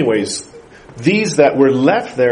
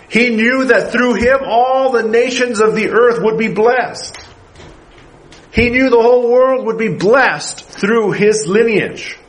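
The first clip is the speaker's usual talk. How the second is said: rising preaching; 6 semitones higher than usual. Acted. Pastor pressing the congregation, preaching higher. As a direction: drive the claim home to the congregation.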